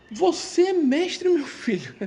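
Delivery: put-on high, slow, wondering old man's question; amazed old grandfather. Grandfather asking his grandson again whether he is a master, high and slow in wonder. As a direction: stretch it out, high and slow, as if he can't believe it.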